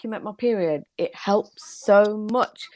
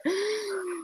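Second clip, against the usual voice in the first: offended gasp; 12 semitones higher, 8 dB quieter than usual. Retold or imitated.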